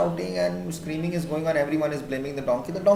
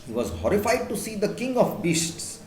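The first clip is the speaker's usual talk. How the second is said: plain reading voice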